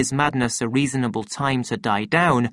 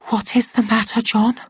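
hushed, high-pitched anxious question; frightened whisper; 9 semitones higher, faster than usual. A narrator's reading. Lenina facing his mad stare, frightened hushed high voice. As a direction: ask it timidly, high and scared. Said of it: "she whispered".

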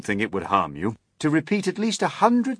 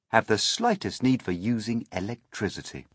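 plain reading voice

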